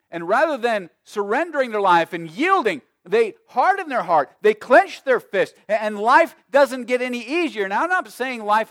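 high, loud pulpit delivery; preaching at full force. Real, not acted. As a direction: hammer each phrase at the congregation.

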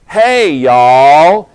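loud drawn-out Southern "Hey, y'all"; Southern greeting. Retold or imitated. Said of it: "teach them Southern".

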